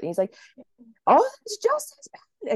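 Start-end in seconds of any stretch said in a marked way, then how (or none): 1.1-2.5 very high, loud panicked squeal; freaking-out doctor. Retold or imitated. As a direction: shriek it in mock alarm. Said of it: "he's freaking out".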